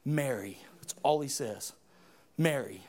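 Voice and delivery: very soft and low; tender whisper of a name. Acted. Jesus softly calling Mary by name. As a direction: say her name gently, barely above a breath.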